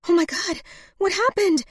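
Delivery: high, loud, shocked question; horrified. A narrator's reading. Woman finding him bleeding, high horrified cry. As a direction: cry out in horror, pitched high. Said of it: "realizing with horror".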